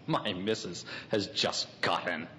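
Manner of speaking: soft, low mimicry of McCoy's boast; quietly mock the friend's words under the breath; mocking echo of McCoy. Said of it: "Bloom mockingly echoes McCoy".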